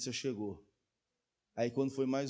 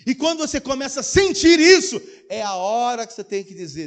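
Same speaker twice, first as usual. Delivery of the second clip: preaching at full force; 10 semitones higher, 14 dB louder than usual. Real, not acted. Preacher building up.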